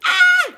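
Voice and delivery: very high loud shriek; high thrilled shriek; joyful scream. Real, not acted.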